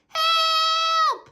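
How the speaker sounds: high, loud yell for help; shout. A narrator's reading. Child yelling for help as he is chased. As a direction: shriek it out in alarm. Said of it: "Grandma shouted".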